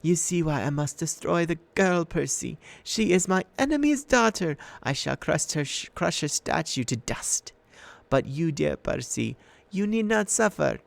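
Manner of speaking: high, silky comforting voice; comforting purr. A narrator's reading. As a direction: purr the threat kindly. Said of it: "back to a comforting pure purr".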